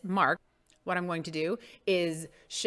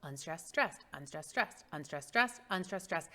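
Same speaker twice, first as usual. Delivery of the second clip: quieter, steady paired beats; soft rhythmic counting; 2 semitones lower, 8 dB quieter than usual. Shown as a demonstration. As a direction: tick off the beats under the verse, softly. Counting out the iambs softly.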